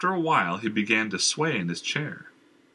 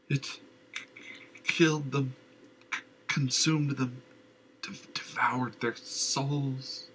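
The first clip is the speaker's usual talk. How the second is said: frightened stammer; 4 semitones higher, 4 dB quieter than usual. Acted. Dazed madman stutters.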